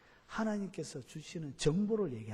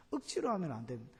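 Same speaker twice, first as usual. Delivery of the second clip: normal talking voice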